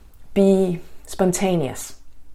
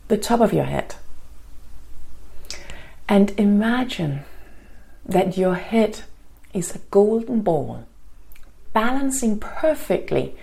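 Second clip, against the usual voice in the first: guided meditation. Acted. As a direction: ease them into calm, gently.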